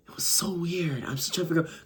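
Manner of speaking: softly whispered, almost to herself; whispered aside. Real, not acted. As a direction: breathe it out like a secret. Hushed aside about the weirdness.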